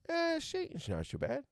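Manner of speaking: high, far-off dismissive shrug; mock shrug voice. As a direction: toss it off like it's nothing. Voicing God shrugging off Satan.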